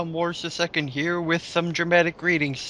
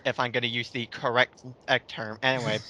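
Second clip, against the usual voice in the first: ordinary talk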